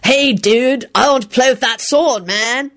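high-pitched sullen dude voice; Kenny. Retold or imitated. Lupin mimicking someone grabbing his sword, sullen voice. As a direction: imitate a whiny, sullen dude begging. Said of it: "authentic sullen Kenny voice".

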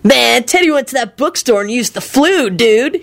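casual, knowing stoner lilt; knowing dude. A narrator's reading. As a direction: explain it like a know-it-all kid. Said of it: "he replied knowingly".

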